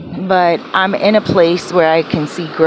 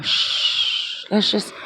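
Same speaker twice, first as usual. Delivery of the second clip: soft shushing, quieter; hushing whisper; 6 dB quieter, slower than usual. Retold or imitated. Telling her hyper friend to calm down, hushed and soft. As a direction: hush him down gently. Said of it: "and I said to him".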